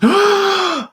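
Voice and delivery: sharp, high imitated gasp; imitated gasp of shock. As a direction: gasp like a man hit by a sudden truth. Trainee's sudden realization retold.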